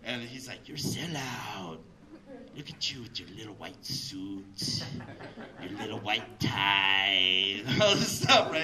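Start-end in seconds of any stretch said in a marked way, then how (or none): 0.7-7.6 slow, low, drawn-out homie taunt; angry tenth grader. Retold or imitated. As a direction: imitate a teen sneering slowly at a sellout. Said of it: "an angry tenth grader".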